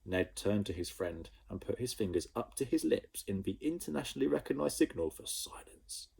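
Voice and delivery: ordinary delivery, nothing marked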